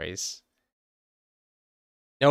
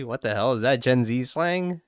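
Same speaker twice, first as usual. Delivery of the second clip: ordinary talk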